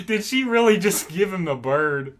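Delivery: laughing through the question; real laugh, amazed she did it; laughing disbelief. Real, not acted. Laughing at Tara's gesture.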